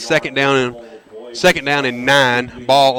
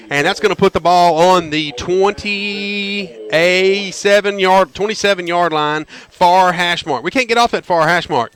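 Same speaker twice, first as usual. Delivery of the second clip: usual speaking voice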